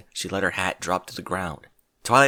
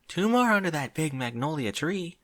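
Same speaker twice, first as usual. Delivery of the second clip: timid Fluttershy voice; 6 semitones higher than usual. Acted. Fluttershy counting.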